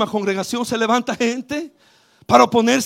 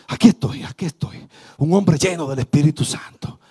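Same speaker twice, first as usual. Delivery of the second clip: low, soft preaching voice; quiet sermon; 7 semitones lower, 4 dB quieter than usual. Real, not acted. Pastor speaking softly to the congregation, low and quiet. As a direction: draw them in softly. Softer, lower preaching stretch.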